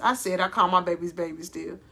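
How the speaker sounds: ordinary delivery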